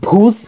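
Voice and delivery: ভুস as one syllable with aspirated bh; correct joined pronunciation. Shown as a demonstration. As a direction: say ভুস in a single breathy-onset beat. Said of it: "কি হবে?". (ভুস, একসাথে এক ধ্বনিতে)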